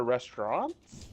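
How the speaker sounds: "restaurants" over-articulated, voice leaping up at the end; "restaurants" as an overdone, carefully sounded question; over-pronounced. Real, not acted. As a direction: hit every sound of "restaurants" too hard. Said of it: "overpronounced the word so hard".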